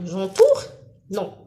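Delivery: usual speaking voice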